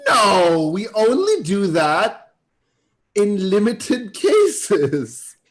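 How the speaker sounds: high, loud, drawn-out denial; cheery reassurance. Acted. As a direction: stretch it out with forced cheer. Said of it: "I put on my cheery face".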